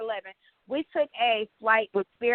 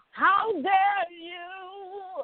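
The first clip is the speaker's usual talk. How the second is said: mock [name]; 7 semitones higher, much slower than usual. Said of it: "that bitch gonna be [name]".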